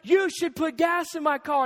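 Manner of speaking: retold indignant insistence; mock arguing. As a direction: act out a wife pressing her side of a quarrel. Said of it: "we're arguing back and forth".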